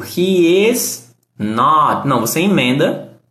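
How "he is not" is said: "He is not" said slowly word by word; careful uncontracted "He is not"; full form, slow. Shown as a demonstration. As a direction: spell out "He is not" slowly, each word apart. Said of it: "ao invés de dizer, por exemplo".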